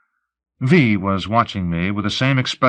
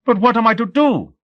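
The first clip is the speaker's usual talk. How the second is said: high-pitched helpless plea; babbling; 12 semitones higher than usual. A narrator's reading. Captive at a loss before the interrogator, babbling. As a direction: blurt it out high and desperate. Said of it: "I babbled".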